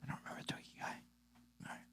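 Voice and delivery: whispered aside to co-host; hushed so the guest won't hear. Real, not acted. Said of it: "Just tell him you do".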